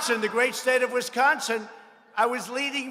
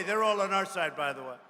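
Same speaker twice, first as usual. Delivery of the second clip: usual speaking voice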